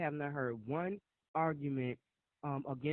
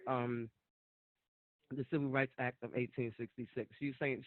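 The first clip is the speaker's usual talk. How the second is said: ordinary delivery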